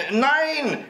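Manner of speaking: put-on very high childish yell; shrieked refusal. A narrator's reading. Häwelmann refuses the old moon, high shriek. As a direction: shriek it like a defiant little boy. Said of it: "schrie Häwelmann".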